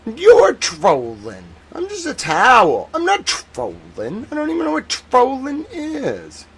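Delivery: high, slow innocent voice; ironic zoomer. Pretend. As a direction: play dumb, wide-eyed and high. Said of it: "you gotta be like".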